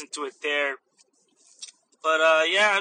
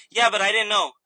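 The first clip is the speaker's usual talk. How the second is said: mildly indignant customer, a bit louder; mock protest; 3 semitones higher, 5 dB louder than usual. Customer arguing with the cashier over bag charges, mock protest.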